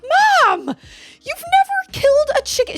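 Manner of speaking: put-on high, loud teenage wail; exasperated protest. Daughter objects to mom killing a chicken, loud exasperation. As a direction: whine it loudly in disbelief.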